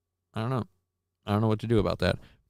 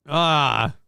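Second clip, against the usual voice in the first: usual speaking voice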